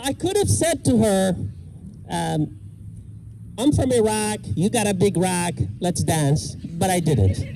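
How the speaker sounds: usual speaking voice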